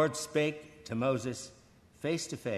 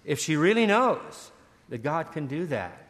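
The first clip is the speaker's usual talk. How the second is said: astonished thought; 7 semitones higher, 3 dB louder than usual. Retold or imitated. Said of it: "And I thought".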